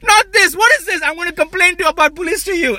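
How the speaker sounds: high-pitched, loud panicked cover-up; panicked outburst. Retold or imitated. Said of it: "he panics, looks at her".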